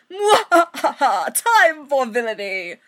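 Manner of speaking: slow, loud evil villain laugh; mad scientist. Acted. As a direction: cackle it out like a cartoon villain. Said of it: "they're like".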